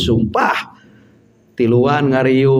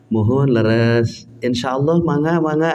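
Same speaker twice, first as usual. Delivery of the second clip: Sundanese Parung Kuda speech. Retold or imitated. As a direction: speak gently like a humble villager. (logat Sunda yang halus dan pelan)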